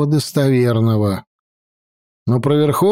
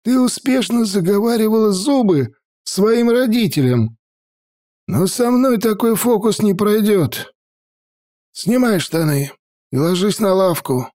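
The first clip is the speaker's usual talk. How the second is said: put-on old mage voice; 8 semitones higher than usual. A narrator's reading. Stern grandfather mage voiced.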